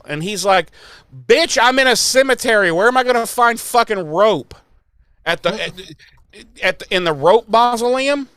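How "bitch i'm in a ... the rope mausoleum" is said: much higher, louder incredulous rant; exasperated rant. Acted. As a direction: fire back in disbelief. Xander exasperated at Buffy's request.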